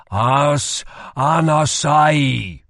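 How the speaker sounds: slow, loud spell command; commanding incantation. Acted. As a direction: drive the magic word out with force. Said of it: "he ordered".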